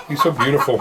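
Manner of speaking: usual speaking voice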